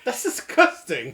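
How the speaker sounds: loud, high disgusted outburst; disgusted shout. Real, not acted. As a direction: yell it in disgust. Reacts to burping.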